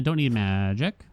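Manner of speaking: ordinary talk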